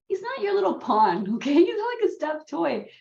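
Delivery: high, loud retold telling-off; indignant mama, acted out; mock scolding. Mama voiced scolding her older son.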